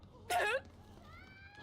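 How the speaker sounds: high squeaky cartoon mouse laugh; Mickey Mouse laugh. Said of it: "I used to could do Mickey Mouse".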